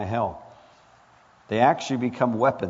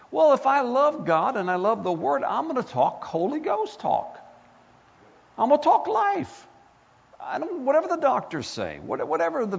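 high, impassioned preaching voice; rising sermon intensity; fervent preaching; 11 semitones higher than usual. Acted. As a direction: preach it up with fire. Preaching declaration of faith.

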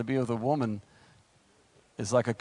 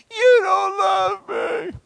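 slow, high, shouted wail of a wronged wife; whiny wife shout; 7 dB louder, much slower than usual. Acted. Preacher mocking a sulking wife, slow high shouted wail. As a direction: wail it like a sulking bride, drawn out. Preacher mimics wife's complaint.

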